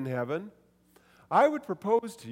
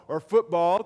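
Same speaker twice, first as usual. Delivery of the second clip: usual speaking voice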